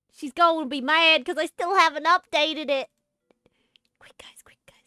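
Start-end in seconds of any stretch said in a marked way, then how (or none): 4.0-4.8 hurried, whispered warning to the chat; urgent whisper. Pretend. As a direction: hiss it like a conspirator. Hiding the drawing from Smokey.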